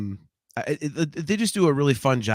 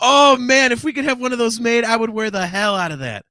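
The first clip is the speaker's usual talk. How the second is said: excited; 6 semitones higher, 7 dB louder than usual. Real, not acted. Excited about shirt idea.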